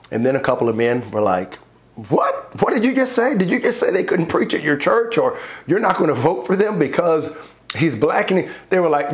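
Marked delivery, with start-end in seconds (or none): 2.1-8.3 high-pitched incredulous questioning; imitating shocked objectors, starting high and easing down; retold indignant disbelief. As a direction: challenge them as if you can't believe it. Retold shocked objection from other men.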